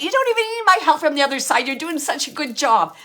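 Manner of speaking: excited, laughing. Retold or imitated. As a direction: gush it out quickly with a laugh in it. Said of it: "she's laughed".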